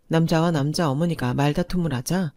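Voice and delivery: plain reading voice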